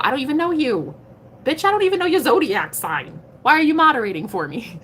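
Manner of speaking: high-pitched, incredulous telling-off; mock indignation. Streamer confronting unknown moderators, mock indignant. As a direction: challenge a stranger with playful outrage.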